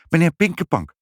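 high, quick reply; high-voiced, ready answer; prompt answering mother. A narrator's reading. Mother promptly supplies the name.

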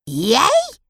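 high, loud, rising question; sharp disbelief. A narrator's reading. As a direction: snap it back, incredulous. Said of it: "snerpt ze".

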